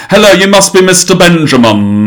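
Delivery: high, loud mock posh English accent; exaggerated English accent. Mocking a film's English mum in a posh English accent. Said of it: "they're always overly, like, British".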